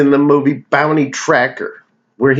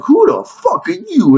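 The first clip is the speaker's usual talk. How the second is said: much higher mock outraged yelp; a hammed-up high freaked-out thug; startled thug. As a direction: squeak it out like a beaten-up crook. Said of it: "the guy's like".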